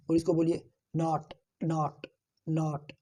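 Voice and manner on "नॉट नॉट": नॉट stretched slowly with an open aa; slow open not. Shown as a demonstration. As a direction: draw out नॉट with a broad aa. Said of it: "आ की sound आ रही है दोस्तों".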